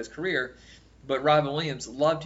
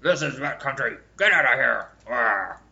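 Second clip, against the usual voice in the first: louder mumble through a pen held in the mouth; pen in teeth; 2 semitones higher, 7 dB louder than usual. Retold or imitated. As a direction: talk around a pen clenched in the teeth. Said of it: "walk around with a pen in my mouth".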